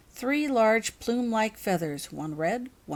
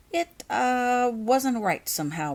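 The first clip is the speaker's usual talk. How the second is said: unsure, hesitant; 6 semitones higher, 3 dB louder, slower than usual. A narrator's reading. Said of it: "not sure how to explain".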